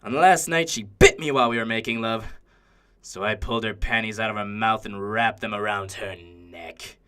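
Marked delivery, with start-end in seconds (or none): 3.0-7.0 low, soft, chilling recital; cold confession. Acted. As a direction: drop low and quiet. Coldly recounts the killing.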